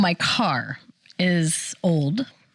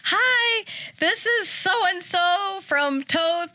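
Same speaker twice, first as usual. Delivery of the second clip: very high, slow greeting; slow sugary saleslady; 10 semitones higher, much slower than usual. Retold or imitated. Mom imitating the dealership caller, very high and slow. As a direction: stretch it sweet like a sales caller. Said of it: "she was like".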